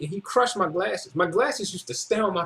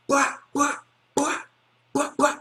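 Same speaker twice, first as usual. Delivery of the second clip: higher, sing-song mock chatter while smacking; mocking blah-blah; 7 semitones higher than usual. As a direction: taunt him with babble. Mock noise while smacking head.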